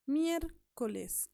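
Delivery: miércoles very slowly, with miér high; slow teacher's demo of miércoles, first syllable punched; stress on antepenultimate syllable. Shown as a demonstration. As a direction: split off and lift miér in miércoles. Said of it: "elevación de voz".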